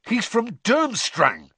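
slow, high, accusing; heated, drawn-out accusation; spitting anger. A narrator's reading. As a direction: spit out the words. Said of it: "spat Ron".

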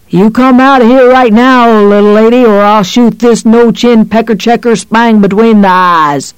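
loud, high, taunting gunpoint threat; bully her out with the gun; menacing threat. A narrator's reading. Monster cop threatens mother at gunpoint, loud and taunting.

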